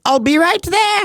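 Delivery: high-pitched imitation of a latecomer; high, airy promise in mock imitation; mock call-out. Imitates late friend calling out.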